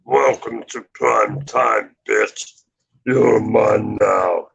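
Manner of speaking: slow menacing slasher voice; lightly voiced horror villain; Freddy Krueger voice. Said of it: "I do Freddy Krueger pretty good".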